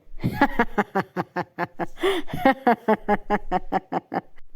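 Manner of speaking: long burst of amused laughing; hearty laughter. A narrator's reading. Husband amused by his jealous wife, laughing at length. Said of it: "عشان تعلى ضحكات جاسر".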